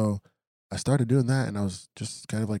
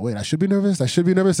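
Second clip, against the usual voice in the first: sudden panicked alarm, higher and louder; freaking out; 10 semitones higher, 11 dB louder than usual. Retold or imitated. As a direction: suddenly realise you should be scared and blurt it in panic. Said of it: "And I start freaking out".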